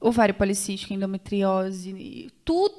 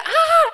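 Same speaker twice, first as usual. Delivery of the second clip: high pained yelp; husband's yell, acted out; imitated scream; 9 dB louder than usual. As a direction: mimic his cry of pain.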